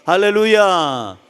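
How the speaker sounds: loud, long-drawn pulpit call; rousing hallelujah. Real, not acted. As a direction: draw the word out loudly to rouse the congregation. Preacher calls for congregation's amen.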